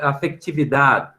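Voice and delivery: "afetividade" said the Spanish way as afectividad, with a k sound and no final vowel, slowly; Spanish pronunciation. Shown as a demonstration. Teacher showing students the Spanish word, Spanish pronunciation. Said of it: "Olha como é que se pronuncia no espanhol".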